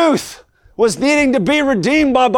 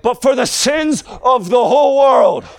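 full-force preaching; 6 semitones higher than usual. Acted.

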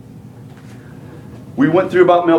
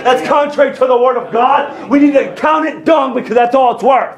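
high-pitched, fervent pulpit delivery; full-force preaching; 7 semitones higher than usual. Real, not acted. Preacher urging church to drop its religion, impassioned. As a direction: drive it home to the congregation.

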